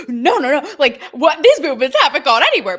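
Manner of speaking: hammed-up panicky no no no; flustered protest. Vlogger imitating Andrew Garfield and Julia Roberts protesting, mock flustered loud denial. Stars' knee-jerk protest.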